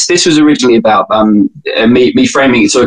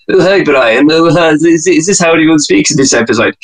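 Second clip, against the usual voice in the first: higher-pitched cartoon British voice; Stewie from Family Guy; 5 semitones higher than usual. Doing Stewie as a fake British accent, cartoon impression. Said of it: "Stewie from Family Guy saying".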